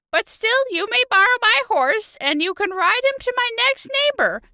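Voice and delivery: put-on old lady voice. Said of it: "I get to pull out my old lady voice".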